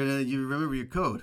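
ordinary talk

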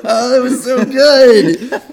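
real laughter, drawn-out words; cracked-up praise; laughing. Real, not acted. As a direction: gasp it out delightedly through laughs. Cracking up after banjo bit.

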